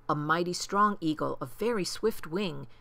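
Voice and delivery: ordinary delivery, nothing marked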